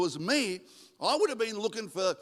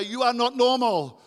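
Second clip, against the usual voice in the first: louder, higher proclamation; emphatic declaration; 5 semitones higher, 7 dB louder than usual. Shown as a demonstration. Preacher has congregation tell the person beside them, emphatic declaration. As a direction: call it out for everyone to repeat. Modelling what to tell your neighbour.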